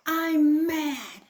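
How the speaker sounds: put-on loud, high child shouting mad; angry child. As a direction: stamp it out like a cross toddler. Jilly's tantrum voice.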